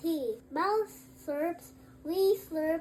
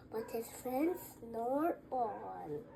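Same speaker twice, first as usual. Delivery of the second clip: hushed, fading last line; soft, sleepy ending; 5 semitones lower, 11 dB quieter than usual. A narrator's reading. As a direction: let it drift off into sleep.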